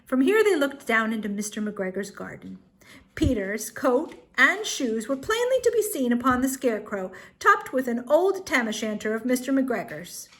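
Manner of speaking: ordinary delivery, nothing marked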